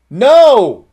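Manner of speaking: loud, high, emphatic "No.". Real, not acted. Preacher answering his own question with a booming no. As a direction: slam the answer down hard. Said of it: "Emphatically no".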